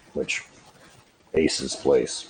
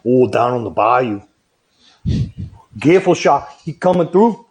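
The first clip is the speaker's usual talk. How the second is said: put-on Cajun accent; 4 dB louder than usual. Showing how his home accent sounds, in a mock Cajun accent. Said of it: "my accent gets way more".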